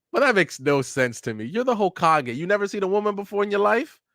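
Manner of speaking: high-pitched rant rising in disbelief; incredulous. Real, not acted.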